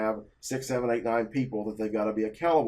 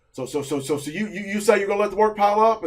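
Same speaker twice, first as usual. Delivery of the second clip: higher, louder mock tirade at an employee; boss going off; 7 semitones higher, 7 dB louder than usual. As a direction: bark it like an angry boss. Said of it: "going off on me".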